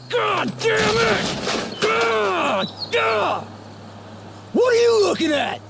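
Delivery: high, slow furious shout; enraged outburst at whoever stares; furious yelling. Acted. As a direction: explode after the call drops. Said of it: "sorry I yelled at you earlier".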